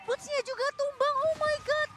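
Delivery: loud, hyped play-by-play call; fighting game announcer. Acted. Live esports play-by-play. (komentator pertandingan yang heboh)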